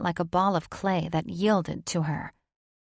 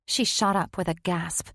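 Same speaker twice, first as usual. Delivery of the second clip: ordinary delivery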